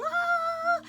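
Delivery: «ah» agudo y fuerte; grito de alegría imitado. Retold or imitated. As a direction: imitar la exclamación alegre de la familia. Whole family cheers at the news. (chillido festivo imitado)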